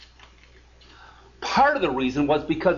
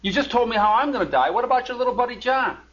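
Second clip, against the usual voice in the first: higher, mock-indignant protest; upset Peter; 8 semitones higher than usual. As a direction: play Peter objecting, a bit put out. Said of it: "Peter gets upset".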